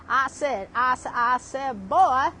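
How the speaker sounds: blustering cartoon rooster, loud final word; Foghorn Leghorn. Imitating a Texan former spouse, blustering rooster voice. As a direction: repeat like a cartoon rooster, booming the last word. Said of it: "he spoke like Foghorn Leghorn".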